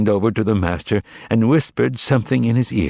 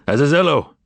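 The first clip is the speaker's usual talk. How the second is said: a raised, summoning call; 5 semitones higher, 3 dB louder than usual. A narrator's reading. Voland summoning Azazello, calling out. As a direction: call for a servant.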